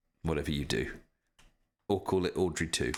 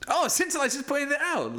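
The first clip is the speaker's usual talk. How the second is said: high excited reaction; 4 dB louder than usual. Real, not acted. Reacting to chat comment.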